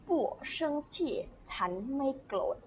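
normal talking voice

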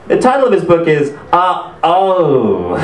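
drawn-out hesitation, played for laughs; mock flustered host. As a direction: imitate a host stuck on a title. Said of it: "he doesn't know what to say".

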